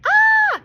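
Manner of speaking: piercing high sustained scream; waitress shrieking at a squirrel in the box; long loud scream. A narrator's reading. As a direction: scream at full lung. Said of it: "Rita screamed a long, impossibly loud scream".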